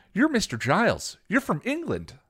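high, bright beaming robot greeting; light up like an eager machine. A narrator's reading. Robot beams at Giles arriving home. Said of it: "the bot beamed".

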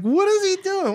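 high-pitched shocked imitation; mock disbelief. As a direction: squeal in disbelief. Retold own shocked reaction.

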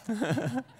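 a short rising self-conscious laugh; embarrassed laugh. Real, not acted. Pastor laughing off a failed impression, short rising laugh. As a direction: laugh at your own bad attempt. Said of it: "not good".

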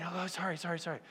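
put-on a child's hushed, cowed apology; timid apology. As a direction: shrink back and apologize quietly. Child apologizing to angry parent.

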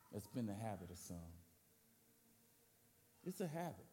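low, soft pastoral voice; soft earnest preaching. Acted. Pastor urging members to keep meeting, low and soft. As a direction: bring it down close.

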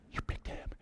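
hushed, incredulous whisper; disbelieving whisper. Retold or imitated. Players still in line, imitating an incredulous whisper.

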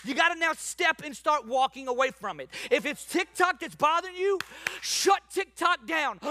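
high, building sermon delivery; fired-up preaching. Real, not acted. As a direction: press the congregation hard, building to a shout. Preaches with rising force.

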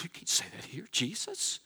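hushed voiceless mock warning; mock whisper. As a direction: whisper it like a scandalised churchgoer. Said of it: "You're like".

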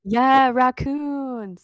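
loud, high drawn-out whoop; rowdy cheer. Real, not acted. Co-host cheers the listener shout-out, rowdy. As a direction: cheer it out, stretching the words.

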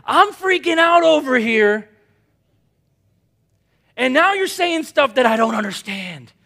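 high, loud panicked Thomas; Thomas freaking out. Retold or imitated. Preacher voicing Thomas in a high loud panic. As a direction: sell the alarm, voice up and loud. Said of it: "Thomas is like".

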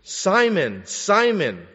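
high, loud call of a name, twice; urgent preacher's call; calling out loudly. Acted. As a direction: call Simon like you need him to turn around. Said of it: "Jesus said".

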